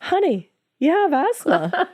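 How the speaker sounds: high, slow, patronizing doctor. Retold or imitated. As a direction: talk down gently like a doctor. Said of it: "the doctor said".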